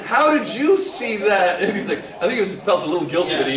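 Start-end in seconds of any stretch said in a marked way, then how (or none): 0.0-1.6 slow, high, loud questioning; alarmed disbelief. Retold or imitated. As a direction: demand it in comic dismay.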